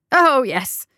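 high, loud, swooping assurance; emphatic. A narrator's reading. Coroner assures the guardsman he is sure, emphatically. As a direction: agree far too eagerly. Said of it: "The coroner nodded emphatically".

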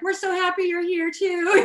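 high, loud, laughing mock-quote of delighted staff; lit-up delight. Restaurant staff greeting returning diners, retold with loud delight. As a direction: gush it back brightly, breaking into a laugh. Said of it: "they all just lit up".

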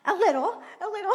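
giggling through the words; chuckling, breaking off mid-phrase; laughing. Real, not acted. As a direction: laugh while repeating it. Laughing at own story.

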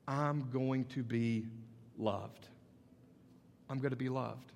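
gentle reassurance after the build; tender hush. Acted. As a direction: drop to an intimate whisper-like close. Soft climax after build.

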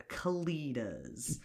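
breathy whisper of the name; hushed Kalitas, voiceless. Real, not acted. Whispered aside about the creatures.

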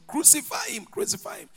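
high-pitched cry, falling to a hush; mock crowd cry. Crowd turning on Jesus, mock crowd cry. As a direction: imitate the crowd high, without shouting.

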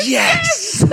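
high, loud triumphant shout; triumphant yes. Retold or imitated. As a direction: cheer it like a win. Triumphant cheer after being caught.

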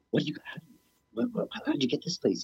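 high-pitched, stammering astonishment; amazed questioning. Retold or imitated. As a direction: act out being amazed by the place. Amazed on seeing the squat.

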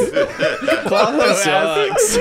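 laughing. Real, not acted. Laughing at the character.

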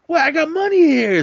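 very high, loud whiny objection; squeaky mock protest. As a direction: plead like a petty gambler. Coffee Cake protesting being removed.

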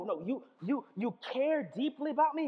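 ordinary delivery

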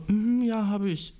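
high, polite reluctant yes; imitating her. She politely agrees to share her account, high and hesitant. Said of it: "sie war dann so".

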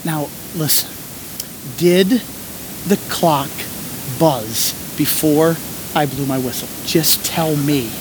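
ref gently asking the scared volunteer about the buzzer; lightly voiced kind ref. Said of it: "he was so sweet".

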